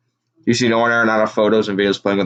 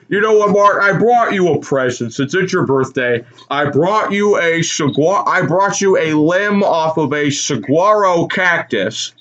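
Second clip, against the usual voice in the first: higher, proud, slowed; mock Walton gift; 5 semitones higher than usual. Walton giving his partner a birthday gift, higher proud voice. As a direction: present it grandly.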